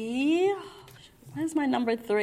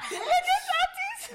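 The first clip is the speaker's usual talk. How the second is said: high, squealing laugh through words. Real, not acted. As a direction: laugh while pointing her out. Laughing at friend's reaction.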